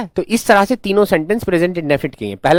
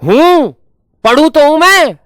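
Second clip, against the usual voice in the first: high, loud protest stressing मैं; a whiny child insisting "पढ़ू तो हूं मैं"; emphatic protest; 11 semitones higher, 8 dB louder than usual. Shown as a demonstration. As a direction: protest hard that you do study, hitting मैं. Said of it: "तुम क्या कहोगे?".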